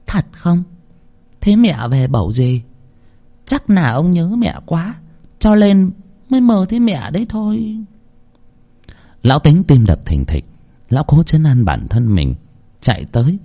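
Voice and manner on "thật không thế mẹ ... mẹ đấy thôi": higher woman's voice, uneasy; lightly voiced wife. A narrator's reading. Wife nervously comforts her husband. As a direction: reassure him though scared.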